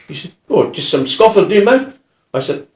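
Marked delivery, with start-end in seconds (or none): 0.5-2.0 lightly voiced rough bloke, pitched up; a hint of streetwise offender. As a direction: imitate a rough customer placing an order. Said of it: "he was quite a rough gentleman".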